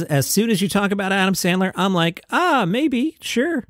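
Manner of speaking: high, hesitant mock actress voice; mock Drew Barrymore. Host quotes an actress tempted by a Sandler film in a mock girlish hedge. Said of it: "She says".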